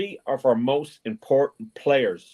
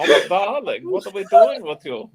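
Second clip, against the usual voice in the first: high, loud, flamboyant put-on voice; a high theatrical Franck voice; Father of the Bride impression; 10 semitones higher, 6 dB louder than usual. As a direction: camp up the wedding planner, laughing. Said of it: "Father of the Bride. Hans?".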